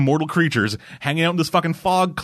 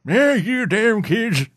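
put-on higher, slow cranky old man voice; grumpy old man; 10 semitones higher, 3 dB louder, slower than usual. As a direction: play a crotchety old man yelling at kids. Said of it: "that's him as an old man".

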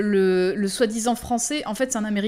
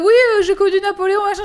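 mock Booker quote; 10 semitones higher, 7 dB louder, faster than usual. Quoting Booker mockingly.

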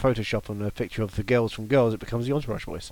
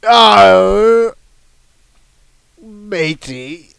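pirate; 6 semitones higher, 10 dB louder, much slower than usual. Acted. Co-host accused of being a pirate, playing up pirate voice. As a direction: draw it out like a hammy pirate. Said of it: "Now you're a pirate".

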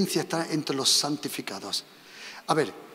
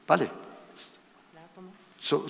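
ordinary talk, nothing marked

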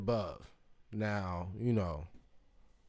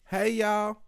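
higher-voiced Southern greeting; imitated Southern drawl, pitched up; Southern accent; 8 semitones higher, 4 dB louder than usual. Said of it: "why I love Southern accents".

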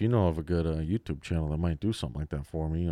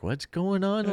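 mock confused grandpa; 13 semitones higher, much slower than usual. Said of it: "And then Grandpa's like".

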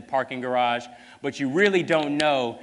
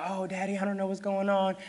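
higher, softer frightened-child imitation; mock tearful daughter; 7 semitones higher, 5 dB quieter than usual. Kidnapped daughter crying to her dad on the phone, mildly tearful imitation. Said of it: "She's crying".

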